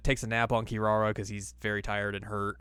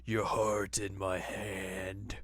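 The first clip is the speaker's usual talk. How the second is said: sinister villain voice. Retold or imitated. Voicing menacing Naraku.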